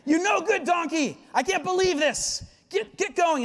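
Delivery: high, hammed-up yelling at the donkey; mock furious. Balaam yelling at his donkey after falling off, mock fury.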